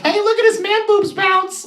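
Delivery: high mocking kid voice; bratty kid jeering through the glass; kids taunting. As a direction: squeal it like a little kid taunting.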